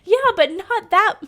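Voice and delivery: loud, high, fast laughing protest. Real, not acted. Streamer answering the donor, loud and laughing. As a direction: laugh through the words.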